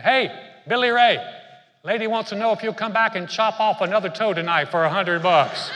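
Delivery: loud, high call across the room to Billy Ray. Retold or imitated. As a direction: holler it out to a buddy across the way. Buddy calls out to Billy Ray.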